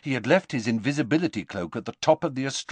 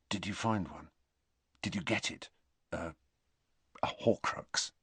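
much softer, near whisper; hushed whisper; 2 semitones lower, 11 dB quieter than usual. A narrator's reading. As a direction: afraid the furniture listens. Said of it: "in a very low voice".

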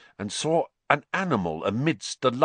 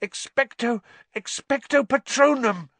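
high, louder pleading cry; desperate cry; 11 semitones higher, 3 dB louder than usual. A narrator's reading. Harry defending Sirius, desperate cry. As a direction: plead then force the spell.

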